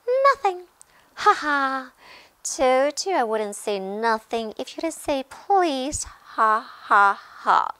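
high, loud cackling imp; gloating taunt. A narrator's reading. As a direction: crow over the trick with mocking ha-has. Peeves gloats over tricking Filch.

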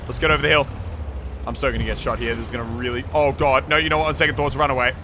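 ordinary talk

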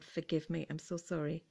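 normal talking voice

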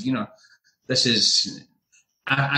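usual speaking voice

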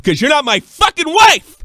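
high, loud outraged punchline; mock furious retort. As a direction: land the jab for the whole bar. Punchline rebuke in the bar.

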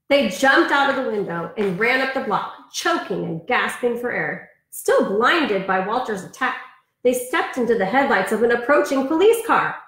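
lively storybook read-aloud; reading to children. Acted. Teacher reading a picture book to her class, in a lively storybook voice. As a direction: read it like bedtime story time for kids.

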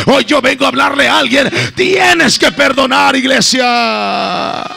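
loud fervent preaching, building to shouts; preaching at full force. Acted. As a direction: thunder the message at the church. Sermon climax, shouted preaching.

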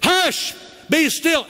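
high, sharp command from the front seat; exasperated parent command. Retold or imitated. Parent quoting the verse at teenagers, high sharp command. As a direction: shut the kids up in the car. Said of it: "that was my life's verse".

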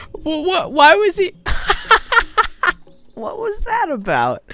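high laughing bewilderment; laughing in disbelief. Real, not acted. Player baffled by a goat scream, laughing incredulously. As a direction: ask it through helpless high laughter.

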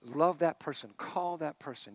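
soft, low, gentle promptings; quiet inner voice. Retold or imitated. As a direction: say it like a still small voice. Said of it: "this clear, quiet voice".

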